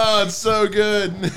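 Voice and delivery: high, drawn-out delight; real high-pitched appreciation; delighted praise. Real, not acted. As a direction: gush over a great joke, pitch way up. Delighted after hearing comedy clip.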